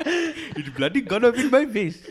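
loud, high mock South African accent; hammed-up South African, breaking into laughter. Joking about the canister, hammed-up South African accent. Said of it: "South African".

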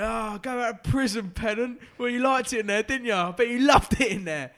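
high, loud mocking jeers at a footballer; taunting shout. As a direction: jeer him from the stands. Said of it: "I was going".

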